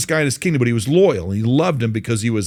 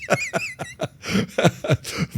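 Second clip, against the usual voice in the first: hearty laugh; 4 dB quieter than usual. Real, not acted. Laughs at own joke.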